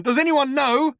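high, urgent yell. A narrator's reading. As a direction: yell to be heard over the singing. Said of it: "yelled Trillian".

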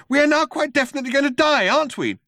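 fast, very high, frantic voice; high-pitched hysterical alarm; panicking. A narrator's reading. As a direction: blurt it in rising panic. Man facing certain death.

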